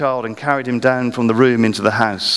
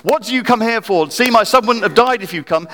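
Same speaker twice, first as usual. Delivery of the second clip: high, indignant accusing voice; accusing woman; 10 semitones higher, 4 dB louder than usual. Retold or imitated. Grieving mother accusing Elijah, high and indignant. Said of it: "the woman's attacking him".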